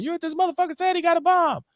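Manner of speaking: high, frantic bystander; panicked high voice. Retold or imitated. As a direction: shriek it in a panic.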